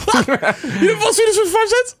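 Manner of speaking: high disbelieving laugh; laughing in disbelief; incredulous laughing. Real, not acted.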